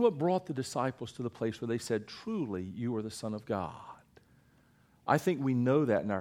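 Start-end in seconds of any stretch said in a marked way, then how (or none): 2.1-3.7 soft, low whisper of worship; whispered confession of faith; hushed awe. Acted. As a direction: breathe it in reverent awe. Repeated quote, whispered.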